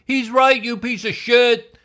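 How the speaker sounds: high, loud mock kid's jeer; jeering like a bratty twelve-year-old; kid with adult voice. A kid behind him jeers at the singer, high and loud. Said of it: "another voice".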